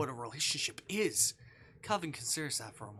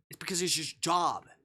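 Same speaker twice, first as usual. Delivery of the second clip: louder, higher snap back; angry retort; 5 semitones higher, 9 dB louder than usual. Acted. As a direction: throw it at him in anger. Protagonist snaps back.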